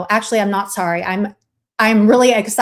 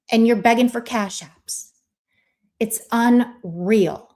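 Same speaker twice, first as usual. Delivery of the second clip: normal talking voice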